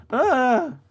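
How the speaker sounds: loud, high cry of exasperation; frustrated yell. Real, not acted. Player penalized by the game, exasperated yell.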